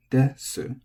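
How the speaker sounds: desu starting high then low. Shown as a demonstration. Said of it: "high, low".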